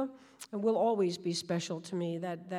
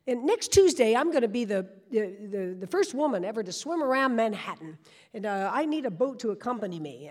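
loud, pushy New York sales pitch; a brash New York accent, pitching to boat owners; thick New York accent; 5 dB louder than usual. Retold or imitated. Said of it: "my spiel in a thick New York accent".

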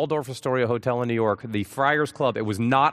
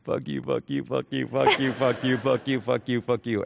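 muttered mock curse. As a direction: mumble it under your breath like an annoyed star. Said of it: "He's just going".

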